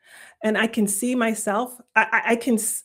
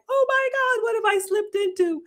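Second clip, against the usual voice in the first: much higher, alarmed; mock panic; 12 semitones higher than usual. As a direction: squeal it in retold horror. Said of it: "and I'm like".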